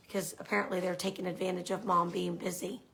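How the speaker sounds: normal talking voice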